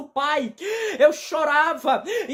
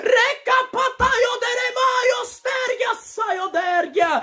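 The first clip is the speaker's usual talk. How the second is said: speaking in tongues; 7 semitones higher, slower than usual. Real, not acted.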